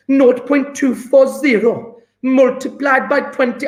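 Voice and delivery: put-on high sing-song Welsh accent, half-joking; a playful stab at a Welsh accent. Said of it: "trying to do a Welsh accent".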